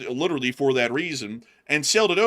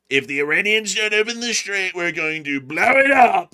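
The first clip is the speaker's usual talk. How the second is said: high, loud blustering threat; mock US threat; 8 semitones higher, 7 dB louder than usual. US government threatening Iran in a loud hammed-up voice. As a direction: ham up a big empty threat. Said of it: "instead of what we've seen so far, which is".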